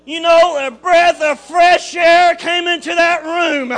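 loud, rising shouted preaching; preaching at full force. Real, not acted. Preacher exhorting the congregation about the Holy Ghost, shouting. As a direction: build the sermon to a roar.

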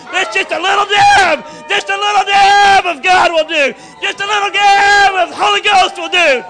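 loud, rising shouted preaching; preaching at full force. Real, not acted. Preacher exhorting the congregation about the Holy Ghost, shouting. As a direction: build the sermon to a roar.

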